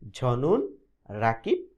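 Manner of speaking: very slow ঝনুন Rakib, drawn out and rising; slow model phrase. Shown as a demonstration. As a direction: spell out ঝনুন Rakib slowly for the class. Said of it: "তাহলে আমাকে বলতে হবে কি?". (ধীরে টেনে ঝনুন রাকিব বলা)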